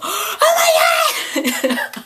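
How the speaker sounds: high, loud shriek of joy; excited shriek. Retold or imitated. In the pharmacy spotting the candy, shrieking with joy. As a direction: squeal it like you just spotted treasure. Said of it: "and I go, gasps".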